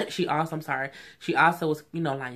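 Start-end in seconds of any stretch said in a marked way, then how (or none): none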